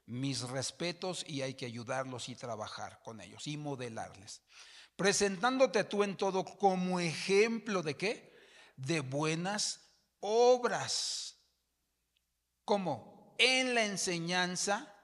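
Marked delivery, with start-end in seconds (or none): 5.0-14.9 loud, high emphatic reading of the verse; full-force sermon reading; preaching scripture. Acted. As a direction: proclaim it to the church.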